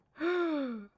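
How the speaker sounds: sharp high gasp of mock shock. Streamer gasping at a scandalous headline, mock shock.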